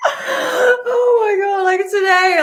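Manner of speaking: overwhelmed, crying out loud. Real, not acted. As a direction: let a real sob break through, slow. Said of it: "why am I so emotional?".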